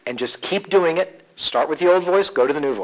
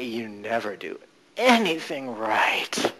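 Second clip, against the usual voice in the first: much slower, lower and softer; slowed, softened; 4 semitones lower, 6 dB quieter, much slower than usual. Shown as a demonstration. Coach softening the mother's voice, low and slow. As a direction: let the nag melt down low and soft. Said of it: "slowing it down and making it softer".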